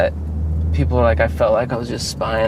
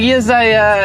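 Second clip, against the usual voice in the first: higher, poorly attempted French accent; bad French accent; 8 semitones higher, 3 dB louder, slower than usual. Mimicking the neighbor's call to police, shaky attempt at French accent. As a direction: imitate a Frenchman, not very well. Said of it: "this is a French accent, which I can't do".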